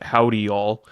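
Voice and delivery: ordinary delivery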